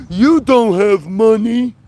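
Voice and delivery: a loud mock commenter voice. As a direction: read the troll's comment in a jeering voice. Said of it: "look at the dumbass comments".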